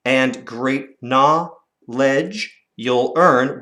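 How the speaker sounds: slow, measured line; slow recital. Shown as a demonstration. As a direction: read it slowly, each beat clear. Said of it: "One, two".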